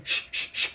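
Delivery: whispered hushing sounds; imitated shushing. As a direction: hush a child with short, voiceless shushes. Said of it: "every time he'd say".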